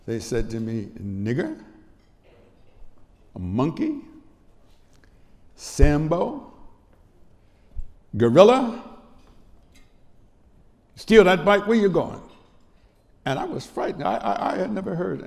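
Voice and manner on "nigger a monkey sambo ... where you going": imitating taunts, building higher and louder; retold shouted taunts. Boy retelling boys surrounding him, retold shouted taunts. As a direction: call out each jeer, rising in force. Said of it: "shout at me".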